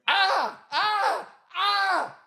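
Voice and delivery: high, loud yelps of pain. Retold or imitated. Yelping on set while the stuntman hurts him. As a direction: cry out like something's pinching hard.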